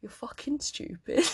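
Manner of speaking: ordinary talk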